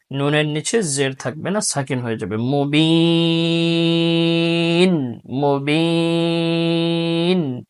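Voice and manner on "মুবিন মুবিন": মুবিন slow, ending on a still nun with no vowel; pausal form. Shown as a demonstration. Teacher showing the pause form to students. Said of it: "এখানে ওয়াকফ করলে".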